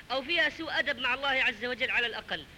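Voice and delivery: normal talking voice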